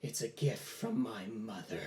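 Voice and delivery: soft, low, slow answer; quiet menace. Acted. Boy revealing his gift to the demon, soft and slow. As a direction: answer slowly under your breath.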